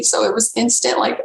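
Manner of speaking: ordinary talk, nothing marked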